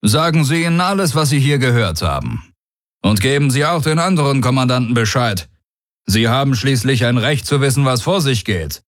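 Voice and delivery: high, lifted commander voice; confident warlord. Acted. Daraios assuring the commander of his oath, high and confident. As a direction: assert it with cool certainty.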